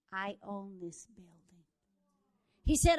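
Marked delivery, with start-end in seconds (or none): 0.1-1.6 whispered, slow and soft; soft, slow whispered admission; hushed whisper. Retold or imitated. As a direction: breathe it like a tearful confession. Man bursts out crying, confessing.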